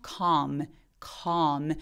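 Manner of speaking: calm with the jaw dropped wide and a silent L; open ah. Shown as a demonstration. Teacher modeling calm with a silent L. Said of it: "턱 엄청 벌리면서".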